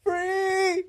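high, slow cry of release; falling rider cry. Retold or imitated. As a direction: wail it out like you're free falling. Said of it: "then imagine".

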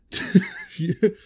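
high burst of laughter. Real, not acted. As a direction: laugh at the pun. Laughs at own pun.

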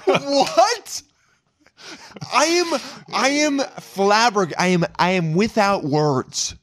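high, slow, stretched-out disbelief; flabbergasted. Pretend. Lost for words at friend's confession, flabbergasted. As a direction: splutter in comic, high-pitched bewilderment.

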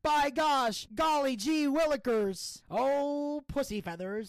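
mock folksy exclamations; old-timer sayings. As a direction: ham up a corny old man. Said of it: "when they say things like".

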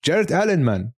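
ordinary delivery

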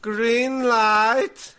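high, singsong chant; giant doll's game call. Acted. Co-host plays the game's giant doll, high singsong call. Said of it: "so she was like".

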